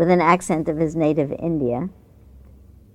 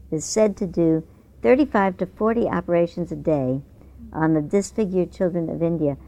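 ordinary talk, nothing marked

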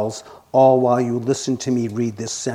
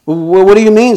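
demanding caterpillar; 8 semitones higher, 12 dB louder than usual. A narrator's reading. Caterpillar voiced, demanding question.

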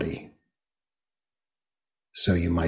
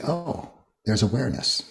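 a small wondering realization; gentle discovery; 6 semitones higher than usual. Retold or imitated. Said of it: "You pause and you say".

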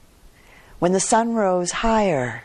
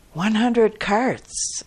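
exclaiming; 5 semitones higher, much slower than usual. Acted. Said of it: "exclaimed others".

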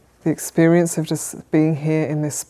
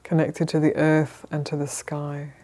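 calm, measured meditation guidance with long pauses; unhurried, even instructions, leaving space to settle; guided meditation. Real, not acted. Teacher settling a group into sitting posture, calm guided meditation.

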